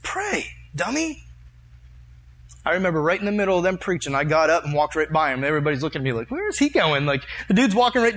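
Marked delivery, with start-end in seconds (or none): 0.0-1.2 high-pitched, teasing little nudge; Holy Spirit. Retold or imitated. Retelling a divine nudge to a slow listener, teasing. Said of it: "Like the Holy Spirit said". 6.3-7.1 high-pitched puzzled onlooker; mock bewilderment. Congregation watching him walk past the preacher, mock bewildered.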